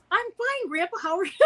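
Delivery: put-on high, sing-song five-year-old on the phone; little child voice. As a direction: imitate a small girl chatting with her grandpa. Acting herself aged five on phone.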